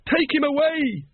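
very high-pitched, frantic command; screamed order. A narrator's reading. Once orders the patrician dragged off, screaming. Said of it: "screamed Once".

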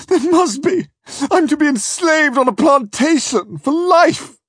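very high, loud horrified protest; anguished cry. Acted. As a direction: wail it out like a condemned man. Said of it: "I cried".

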